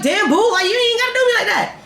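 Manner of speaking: high-pitched, quick, half-laughing complaint; exaggerated wounded reproach; hurt protest. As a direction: plead it high and fast. Retold plea to the sleeping accuser.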